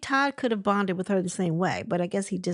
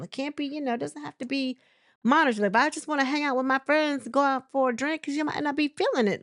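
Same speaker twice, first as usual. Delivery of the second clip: higher, lightly voiced husband explaining himself; a hint of a casual husband, pitched up and quick in places; imitating Todd; 7 semitones higher, faster than usual. Husband explaining breaks to the glam squad, lightly voiced higher. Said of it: "And Todd was like".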